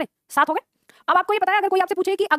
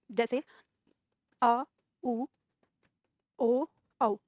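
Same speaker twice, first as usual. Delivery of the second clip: dictation; 3 semitones lower than usual. Acted. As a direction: dictate with long pauses for note-taking. Teacher dictates a definition. (धीरे-धीरे, रुक-रुककर लिखवाते हुए)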